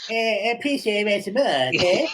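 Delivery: Cartman voice. Pretend. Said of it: "Cartman played Oliver".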